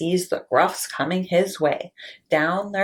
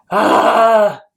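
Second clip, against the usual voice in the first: loud startled troll bellow; troll roar; 3 semitones higher, 9 dB louder than usual. Acted. Troll roaring up at goat on bridge. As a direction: let out a big troll roar.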